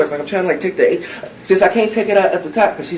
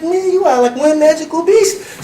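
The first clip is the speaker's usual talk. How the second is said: mock awe; 8 semitones higher than usual. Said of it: "I'm like".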